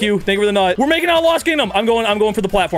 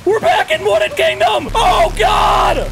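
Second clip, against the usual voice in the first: high, loud half-laughing sob; wailing despair; 13 semitones higher, 3 dB louder, slower than usual. Real, not acted. Gamer falling back a level, wailing and half-sobbing. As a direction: cry out in mock anguish, drawn out.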